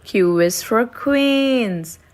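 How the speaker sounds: plain reading voice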